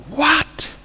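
high, soft squeak of disbelief; incredulous. Acted. Reacting to Lazarus walking out, high-pitched incredulity.

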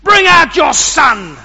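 loud, high, slow shouted demand; angry demand. Acted. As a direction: roar it at the father. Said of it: "demanded of Joash".

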